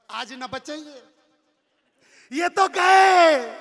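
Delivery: long drawn-out wail, loud and high; slow, loud wailing cry of doom; wailing lament. Acted. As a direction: howl it out like a despairing wife. Wife wails at the scene.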